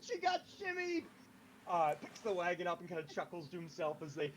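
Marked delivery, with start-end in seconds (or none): 0.0-1.0 high cackling taunt through laughter; high laughing jeer; laughing mockery. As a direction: laugh at her while jeering. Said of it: "points at Hannah, and laughs, going".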